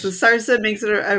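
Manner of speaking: usual speaking voice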